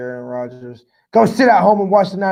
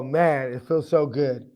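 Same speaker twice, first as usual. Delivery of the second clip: ordinary delivery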